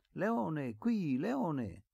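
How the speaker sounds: put-on high-pitched child's call; calling a dog. A narrator's reading. Little Efisio calling his dog back, high call.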